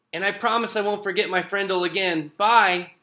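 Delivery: high, fast, ending on a loud bye; cheeky parting shot. A narrator's reading. Nick calls back from the hallway, cheeky schoolboy voice. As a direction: rattle off the promise, then call out goodbye.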